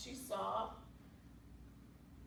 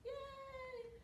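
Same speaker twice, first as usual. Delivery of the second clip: high, soft drawn-out yay; little cheer; 12 semitones higher, 9 dB quieter than usual. Pretend. Cheering the happy ending.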